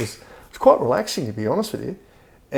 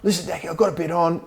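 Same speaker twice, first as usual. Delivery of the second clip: imitating his mate; 7 semitones higher than usual. Imitating Greenie turning him down.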